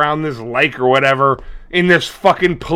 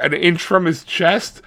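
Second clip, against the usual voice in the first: ordinary delivery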